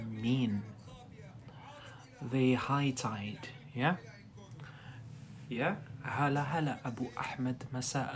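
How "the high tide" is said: drawn-out "The high tide" for learners, each word separate; slow teacher model. Shown as a demonstration. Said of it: "What does high tide".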